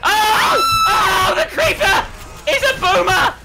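high, loud, drawn-out shriek; alarmed screaming. Real, not acted. Player discovering the creeper is a boomer, high scream. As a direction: scream the words in shocked alarm.

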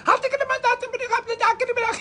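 high-pitched, rapid gibberish in mock ecstasy; hammed-up church tongues; fake tongues. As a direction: burst into faked speaking in tongues, way up high. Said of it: "me a talk in a tongues".

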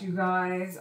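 ordinary talk

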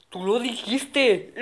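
ordinary delivery

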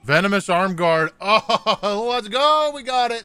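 loud, high, thrilled cheer; excited shout. Real, not acted. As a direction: yell it out in triumph. Rare item drops.

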